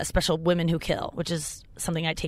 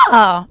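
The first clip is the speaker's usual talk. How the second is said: loud, drawn-out appreciative "ah"; loud mimicked aww of gratitude; touched ah; 11 dB louder than usual. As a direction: act out a big touched "ah". Acts out own reaction to recognition.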